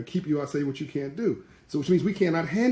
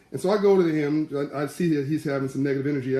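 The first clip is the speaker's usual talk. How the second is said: ordinary talk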